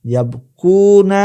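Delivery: ইয়াবকুনা drawn out slow and pitched high, every syllable clear; careful Arabic recitation. Shown as a demonstration. Teacher modelling an Arabic verb form for students, slow and high. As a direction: stretch out ইয়াবকুনা slowly for the class. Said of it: "আশা করি আমার উচ্চারণটা আপনারা বুঝতে পেরেছেন".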